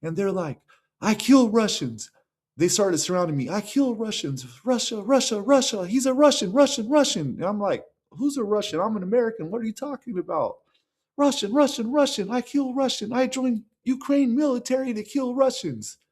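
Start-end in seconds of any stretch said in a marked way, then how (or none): 1.0-2.1 high-pitched mimicked threat; imitated hostile accuser. Retelling Kenyans menacing him. 3.5-7.3 high-pitched mimicked chanting; imitated accusing crowd. Retelling crowd surrounding, accusing him. 8.1-10.6 high-pitched, incredulous retort; re-enacted bewildered protest. His bewildered protest to the crowd. 11.2-16.0 very high mimicked chant and threat; imitated accusing crowd.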